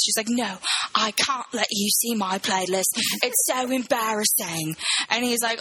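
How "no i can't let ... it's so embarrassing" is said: slow, drawn-out British impression; mock British accent. Embarrassed woman hiding her playlist, slow mock British accent. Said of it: "like, a British accent".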